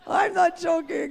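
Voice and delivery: loud, high, slow insistence through laughter; laughing insistence. Real, not acted. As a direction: swear you're not joking while still laughing. Laughing insistence after own story.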